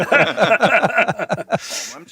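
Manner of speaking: mock shock. Echoes the mocked O M G.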